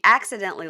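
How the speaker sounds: "accidentally" punched, as if in quotes; sceptical stress on accidentally. Shown as a demonstration. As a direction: lean on "accidentally" as a doubtful claim. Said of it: "lost is in quotes".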